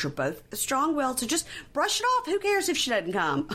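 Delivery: high, dismissive mock mom. Recapper mimics the mom waving off the sister's absence. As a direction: voice the mom brushing it off, pitch climbing up. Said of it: "And the mom's like".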